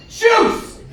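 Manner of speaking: sharp, high whispered bark; bratty kid demand. Retold or imitated. Seven-year-old daughter demanding juice from dad, high and sharp. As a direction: demand it like a spoiled child.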